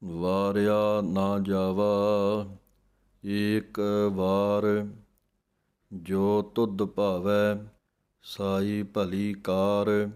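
slow, measured recitation of the verse; scripture recitation. Acted. Teacher reciting the Japji verse for students, slow and measured. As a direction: chant each phrase slowly and evenly.

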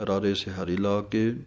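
normal talking voice

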